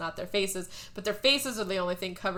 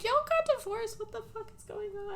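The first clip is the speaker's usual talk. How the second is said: much higher, a squeak of disbelief; shocked disbelief; 13 semitones higher, 5 dB quieter than usual. Retold or imitated. Viewer yelling at the divorced couple in high disbelief. Her own shocked reaction.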